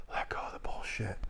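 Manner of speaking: soft, breathy release while demonstrating a breathing exercise; whispered exhale. Retold or imitated. Voicing his breathing exercise.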